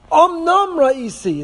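"Omnam" slow and weighty, leaning on the word; emphatic. Shown as a demonstration. Teacher shows the verse's emphasis to his class, slowly. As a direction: draw out "Omnam ra'isi" for emphasis. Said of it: "it's emphasis, it's emphatic".

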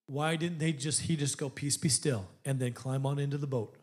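low, soft, hushed sermon; hushed preaching. Acted. Preacher wondering aloud to the church, low and soft.

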